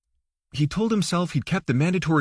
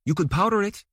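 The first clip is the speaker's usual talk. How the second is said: lightly higher, teasing wife; wife's teasing voice; 6 semitones higher than usual. A narrator's reading. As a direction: tease him about his bald head.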